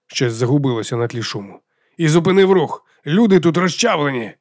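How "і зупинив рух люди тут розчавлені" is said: raised, higher voice over crowd noise; urgent radio shout. A narrator's reading. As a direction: report an emergency over the din. Батько кричить серед шуму й тисняви. (тривожний вигук у рацію крізь шум)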